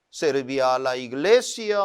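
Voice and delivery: ordinary delivery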